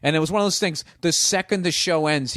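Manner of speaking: ordinary talk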